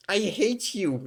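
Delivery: high-pitched mock hatred; old woman. Old woman pissed at the lab worker, hammed-up hatred. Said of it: "she didn't say it like that".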